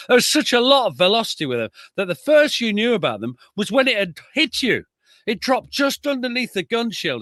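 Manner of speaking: a hint of a Northern veteran recalling being hit; old Derbyshire gunner. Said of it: "this is what Ted Holmes says".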